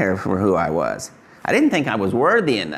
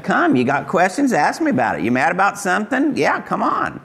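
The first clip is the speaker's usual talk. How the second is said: voice of Jesus; 8 semitones higher than usual. Acted. Preacher voicing Jesus' invitation.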